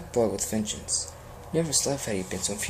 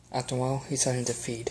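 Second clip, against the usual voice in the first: usual speaking voice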